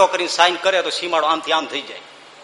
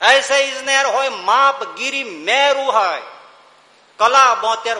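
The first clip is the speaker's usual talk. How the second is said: declaimed verse; 7 semitones higher, slower than usual. Acted.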